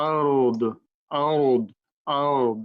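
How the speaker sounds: আরদ with a full, heavy dad and a stopped end. Shown as a demonstration. Teacher drilling the heavy stopped আরদ for students. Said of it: "আমরা এভাবে পড়বো".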